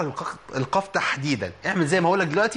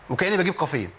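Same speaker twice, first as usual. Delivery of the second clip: usual speaking voice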